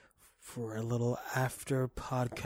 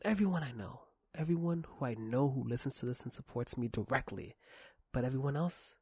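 ordinary delivery

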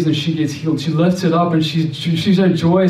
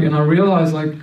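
ordinary talk, nothing marked

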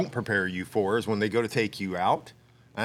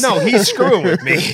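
laughing; 10 semitones higher, 11 dB louder than usual. Real, not acted. Laughing while telling.

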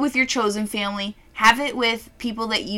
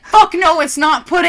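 higher, louder indignant retort; indignant mom; 7 semitones higher, 10 dB louder than usual. Retold or imitated. Mom correcting her about the pudding, loud and indignant. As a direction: snap back offended. Mom insisting on dish name.

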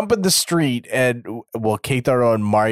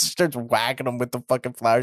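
ordinary talk, nothing marked

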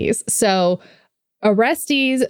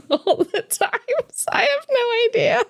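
laughing high. Real, not acted. Laughing at her own confusion.

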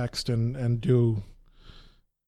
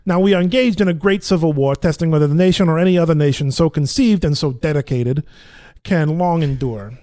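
loud Brooklyn recitation; Brooklyn accent; 5 dB louder than usual. Shown as a demonstration. Actor test-reading the Gettysburg Address, Brooklyn accent. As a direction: proclaim it in an attempted Brooklyn accent. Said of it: "a Brooklyn accent".

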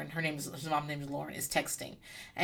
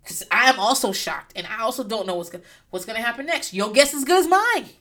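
ordinary talk, nothing marked

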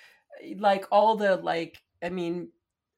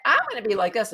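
brighter and louder, bold; one way; 3 semitones higher, 6 dB louder, much faster than usual. Shown as a demonstration. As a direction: push it up bright and loud. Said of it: "[name] is just like".

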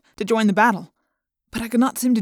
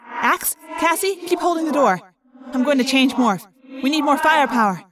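commanding; 6 semitones higher than usual. A narrator's reading. Rachel commanding in battle.

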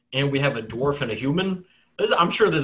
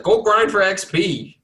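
higher, louder, slow mock order; mock command; 6 semitones higher, 5 dB louder than usual. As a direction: order them about, drawing it out.

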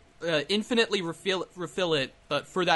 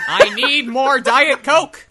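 mock greedy customer; 6 semitones higher, 9 dB louder, slower than usual.

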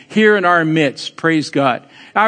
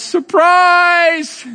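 mock surprise; 9 semitones higher than usual. Real, not acted. Teasing reveal to congregation.

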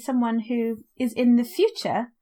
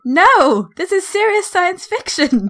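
loud, high mock outrage; comically offended outburst; mock indignation; 8 semitones higher, 10 dB louder, slower than usual. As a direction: protest with hammed-up indignation.

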